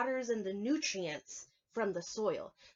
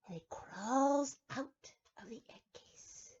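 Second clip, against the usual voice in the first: slow hushed whisper; 4 semitones higher, slower than usual. Acted. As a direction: breathe the words out secretly. Reading the story.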